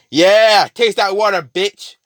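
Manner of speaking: high, loud taunting shout; gloating yell. Retold or imitated. Connor taunting the boiling woman, gloating shout. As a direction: yell it down at your victim with sadistic glee.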